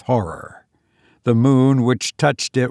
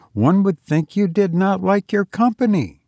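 put-on high girlish teasing; teasing young woman; 8 semitones higher than usual. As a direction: chide him playfully in a higher voice. Olive teases the silent man.

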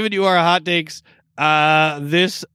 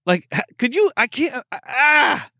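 exasperated; 6 semitones higher than usual. Real, not acted. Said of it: "exasperated outburst".

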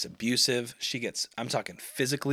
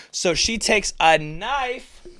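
loud, high, drawn-out announcement; dramatic reveal; 10 semitones higher, 7 dB louder, much slower than usual. Real, not acted. Storyteller revealing the girlfriend's weapon, loud and drawn out. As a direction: stretch it out at a raised pitch for shock.

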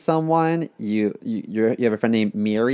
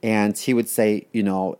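ordinary talk

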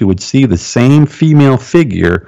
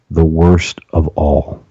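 slow, low, reluctant answer; slow, lowered demon reply with hesitation; hesitant demon; 4 semitones lower, slower than usual. Retold or imitated. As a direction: hold back before admitting it. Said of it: "answered with hesitation".

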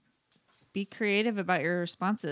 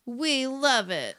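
high, loud and slow gush; 7 semitones higher, 5 dB louder, slower than usual. Real, not acted. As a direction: stretch it out, delighted. Gushing over the poem.

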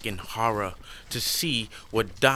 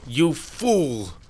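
higher, louder scornful shout; angry; 6 semitones higher, 6 dB louder than usual. Acted. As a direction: snap at him in contempt. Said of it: "Way of Earth says".

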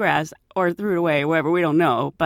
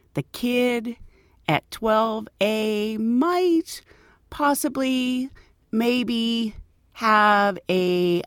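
very slow, halting higher voice of a nervous woman; hesitant passenger; 3 semitones higher, much slower than usual. Retold or imitated. Nervous passenger telling the flight attendant about the snake, slow and halting. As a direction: drag out each word, unsure whether to tell.

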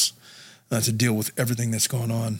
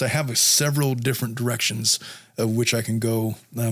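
normal talking voice

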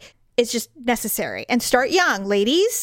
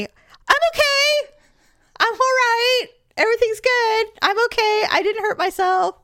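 put-on much higher, chirpy reassuring kid voice; imitating her daughter; 12 semitones higher than usual. Daughter insisting she's fine after almost falling downstairs. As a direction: chirp it out like a kid brushing off a fall.

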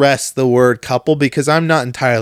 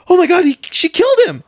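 much higher, a bit louder mock shock; mock shocked girlfriend; 4 dB louder than usual. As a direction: squeal it like she just solved the plot. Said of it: "she goes".

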